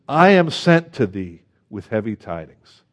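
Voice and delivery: I am sent to thee with heavy tidings, said slow and weighty; grave scripture reading; slow solemn reading. Acted. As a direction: weigh each word like a prophecy. Reading the prophet's words slowly.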